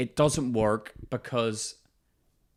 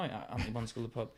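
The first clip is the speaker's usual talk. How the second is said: fast mumble; 4 semitones lower, 14 dB quieter, much faster than usual. Retold or imitated. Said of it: "So I blame it on him now".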